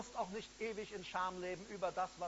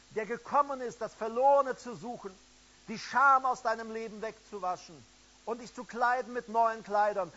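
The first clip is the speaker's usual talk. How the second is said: louder, rising, fervent sermon cry; preaching at full force; 3 semitones higher, 8 dB louder than usual. Acted. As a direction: drive it home to the listeners online. Preacher builds to fervent climax.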